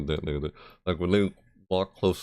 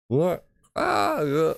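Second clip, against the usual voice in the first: surprised; 11 semitones higher, slower than usual. Real, not acted. Sudden realization.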